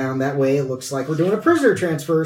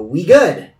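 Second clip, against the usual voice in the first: golem voice; 6 dB louder than usual. Acted. Golem voice with coin.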